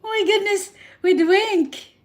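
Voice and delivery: coy, flustered delight; giddy squeal. Real, not acted. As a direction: squeal at the wink. Reacting to a wink.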